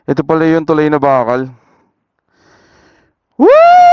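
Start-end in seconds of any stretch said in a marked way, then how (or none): 3.4-3.9 loud high thrilled woohoo; excited whoop. Real, not acted. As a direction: whoop it out with joy. Cheering on a thrill ride.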